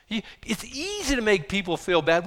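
normal talking voice

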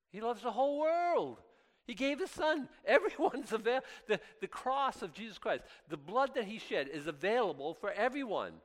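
high, loud, jubilant proclamation; fervent preaching. Real, not acted. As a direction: lift it up and shout the good news.

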